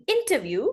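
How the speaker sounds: interview with a clearly sounded T; British T. Shown as a demonstration. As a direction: pronounce the T in interview, not swallowed. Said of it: "she pronounced the T".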